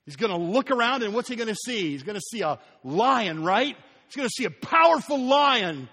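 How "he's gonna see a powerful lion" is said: excited buildup. Acted. Building up the expected lion.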